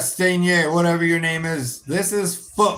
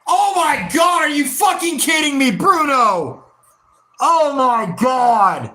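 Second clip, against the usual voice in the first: outraged shout; 8 semitones higher than usual. Real, not acted. Fan watching Bruno miss, high outraged shout. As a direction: explode in disbelief.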